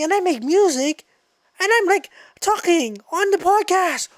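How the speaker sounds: high, animated burst of realization; excited epiphany. Acted. Podcaster acting out his naming epiphany, excited. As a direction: act out being amazed by your own idea. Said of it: "I was probably just like".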